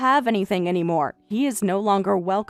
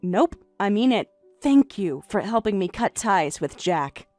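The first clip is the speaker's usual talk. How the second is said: lower, softer, quietly sincere; calm sincere thanks; 9 semitones lower, 6 dB quieter than usual. Acted. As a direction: thank her as if you truly mean it. Wife thanks husband's mistress.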